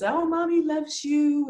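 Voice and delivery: put-on high, slow sing-song baby talk; exaggerated coo to a baby; parentese. Shown as a demonstration. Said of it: "a high sing-songy, you know, what, what I'm talking about, those very exaggerated voice".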